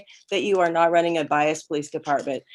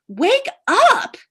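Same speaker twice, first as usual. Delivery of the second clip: much higher, louder shout to wake them up; shouted plea; 13 semitones higher, 6 dB louder than usual. Real, not acted. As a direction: yell it straight at the commissioners, high and loud. Speaker confronting commissioners, pleading.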